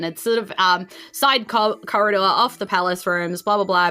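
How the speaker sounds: ordinary delivery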